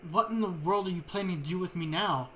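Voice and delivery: usual speaking voice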